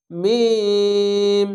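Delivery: মিম with the i held very long and steady; stretched three alif. Shown as a demonstration. Teacher demonstrating the long three-alif vowel to students. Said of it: "এখানেও তিন আলিফ টেনে পড়বেন।".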